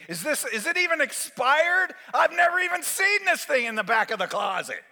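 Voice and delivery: high, loud questioning; acted indignant patient; alarmed suspicion. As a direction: object in mounting panic.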